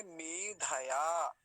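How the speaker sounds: मेधया very slow, long me, separate dha; full dha. Shown as a demonstration. Teacher confirming the full letter, slow three syllables. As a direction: draw out me-dha-yaa in मेधया. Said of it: "पूरा है तो कैसे बोलेंगे".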